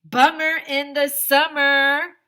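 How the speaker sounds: slow loud goofy catchphrase; character quip. Acted. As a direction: toss it off slowly, loud and bright. Character quips in story.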